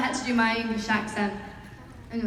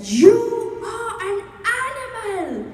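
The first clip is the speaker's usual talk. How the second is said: high, very slow, drawn-out imitation of an outburst; mock accusing cry; 12 semitones higher, 7 dB louder, much slower than usual. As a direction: stretch out each word like a hammed-up accusation. Imitating an odd fan's accusation.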